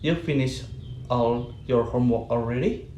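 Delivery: "already" swoops up at the end; rising question intonation. Shown as a demonstration. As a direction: make it a question with a climb on "already". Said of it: "rising intonation".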